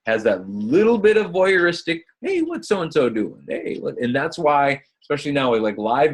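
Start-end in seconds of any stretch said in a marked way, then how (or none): 2.2-4.0 high-pitched, nosy mock quote; nosy onlooker. Webinar host voices curious onlookers, nosy and high. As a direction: lightly act out an eager busybody.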